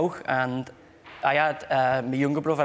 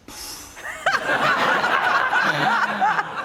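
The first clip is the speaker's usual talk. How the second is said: high, loud burst of laughter; helpless squeal of laughter at himself; shrieking laugh; 5 dB louder than usual. Real, not acted. Sees his own French mannerisms.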